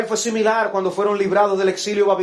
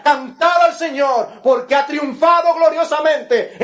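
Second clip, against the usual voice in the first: 1 exultant shout; 9 semitones higher, 6 dB louder, slower than usual. Acted. Miriam's song, shouted as a call.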